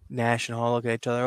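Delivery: normal talking voice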